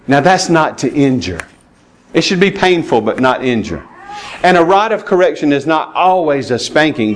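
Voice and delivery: usual speaking voice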